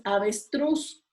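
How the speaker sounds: avestruz drawn out, weight on the final TRUZ; stress on TRUZ. Shown as a demonstration. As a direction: say avestruz slowly, leaning on truz. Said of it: "truz es la sílaba que suena más". (avestruz lento, con la fuerza en TRUZ)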